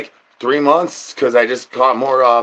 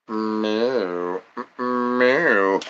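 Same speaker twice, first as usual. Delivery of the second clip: soft meow; 3 semitones lower, 7 dB quieter than usual. Acted. Imitating a cat.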